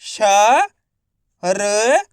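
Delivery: श and र each held alone; letter-by-letter sounding. Shown as a demonstration. Teacher leading pupils to read along, श and र sounded separately. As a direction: stretch श, pause, then stretch र. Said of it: "कैसे पढ़ेंगे?".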